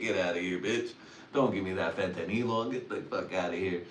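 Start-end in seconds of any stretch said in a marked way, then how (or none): none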